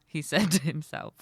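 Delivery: ordinary delivery, nothing marked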